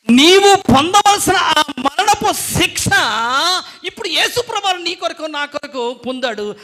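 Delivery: preaching at full force; fervent preaching climax. Real, not acted. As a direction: lift it into a passionate cry. Climax of sermon point.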